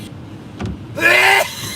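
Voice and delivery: harsh rising choking cough with no voice; real choking cough. Real, not acted. Driver choking on food while streaming, harsh cough. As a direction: hack to clear the throat. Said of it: "I got a piece of stuck in my throat".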